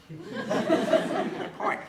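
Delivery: high squeal of laughter on the words; laughing. Real, not acted. Author laughing as he concedes to the inner nun.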